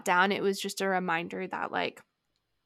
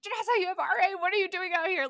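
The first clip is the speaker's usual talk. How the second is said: much higher, anxious self-scolding; retold inner panic; 10 semitones higher, much faster than usual. Climber panicking to herself on the boulder, high and anxious. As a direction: scold yourself in sudden fear. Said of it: "I was just so scared".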